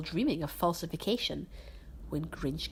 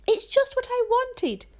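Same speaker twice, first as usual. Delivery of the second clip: fake delight; 7 dB louder than usual. Fake delighted gift quote.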